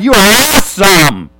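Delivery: loud, high shout of praise; yelling. Shown as a demonstration. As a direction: yell it with your whole self. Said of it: "I'm afraid to yell louder".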